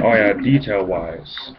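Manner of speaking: normal talking voice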